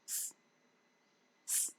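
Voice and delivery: S as a pure hiss, no vowel; phonic S. Shown as a demonstration. Teacher giving learners the letter's sound, hissed S. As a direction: make S just air through a narrow gap. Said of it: "has got a phonic sound of".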